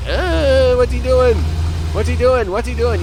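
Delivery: high, baffled mock chatter; confused enemies. Mocking the confused enemies he keeps running over, high and baffled. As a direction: squeak it like puzzled goons. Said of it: "You're all like".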